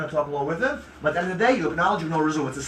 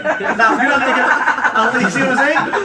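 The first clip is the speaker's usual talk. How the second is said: laughing; 4 semitones higher, 6 dB louder than usual. Real, not acted. Laughing mid-roleplay.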